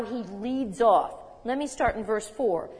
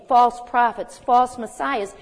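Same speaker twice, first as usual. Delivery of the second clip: louder, driving the words home; emphatic warning; 9 dB louder than usual. Acted. Preacher warning the church of false prophets, loud and emphatic. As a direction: hammer each phrase out. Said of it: "emphasising the warning".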